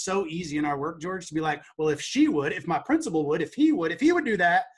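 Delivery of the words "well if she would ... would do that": growing louder, finger-pointing complaint; mock blaming whine. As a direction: build the excuses louder each time. Mimics blaming teachers, building.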